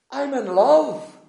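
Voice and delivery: very high, loud, slow holler; shouting back. Retold or imitated. Friend shouting across Marks and Spencer's for all to hear. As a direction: bellow it slowly across a crowded shop. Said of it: "he shouts back".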